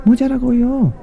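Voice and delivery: very high, eager squeak; very high mouse in Korean. Acted. Mouse points at head.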